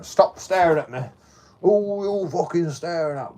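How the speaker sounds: aggressive challenge. Retold or imitated. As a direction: mimic a man yelling back over his shoulder. Aggressor turning round, yelling back.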